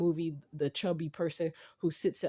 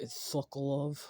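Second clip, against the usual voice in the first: put-on New York accent; 2 semitones lower, 4 dB quieter than usual. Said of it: "New Yorker accent".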